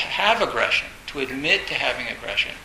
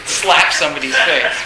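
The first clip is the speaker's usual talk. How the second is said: louder, through laughter; laughing; 10 dB louder than usual. Real, not acted. Teacher still cracking up at the Dalai Lama joke. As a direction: keep laughing through the words.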